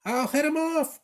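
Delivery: high eager cry; cried. A narrator's reading. Gym teacher joining the chase, high eager cry. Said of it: "cried the gym teacher".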